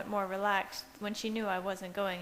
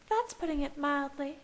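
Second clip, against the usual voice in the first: lightly voiced young woman, higher; a light, higher girl's voice; Alice's voice; 7 semitones higher than usual. A narrator's reading. Alice voiced by male narrator.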